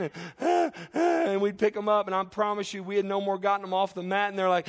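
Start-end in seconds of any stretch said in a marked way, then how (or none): 0.4-1.0 high mock baby wails; imitated baby crying. Preacher imitating babies being picked up, mock crying. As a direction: cry like an infant, short high bursts. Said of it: "and they're crying".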